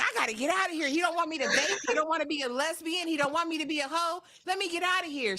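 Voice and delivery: high, worked-up complaint; mock panicked rant. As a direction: imitate someone desperate to bolt. Mimics woman fleeing the argument.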